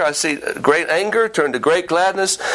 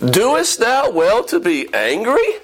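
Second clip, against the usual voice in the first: slow, higher questioning with a rising end; incredulous; 4 semitones higher, slower than usual. Acted. God questioning angry Jonah, slow and incredulous. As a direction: draw the question out in disbelief. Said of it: "it's probably something like".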